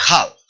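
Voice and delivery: "half" opened with audible h friction; "half" set apart in English. Shown as a demonstration. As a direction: model "half" with a jota-like breath. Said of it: "en inglés se dice".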